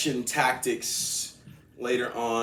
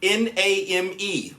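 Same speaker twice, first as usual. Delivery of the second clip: loud, high, one letter at a time; spelled out; 8 semitones higher, 5 dB louder, slower than usual. Retold or imitated. Agent spells a word out for the customer, slow loud spelling. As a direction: spell it slowly and forcefully, letter by letter. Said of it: "She spelled out".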